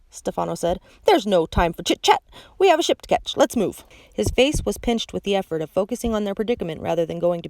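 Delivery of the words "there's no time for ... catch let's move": louder, brusque orders; impatient villain. A narrator's reading. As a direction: hurry them out. Stefano hurrying them.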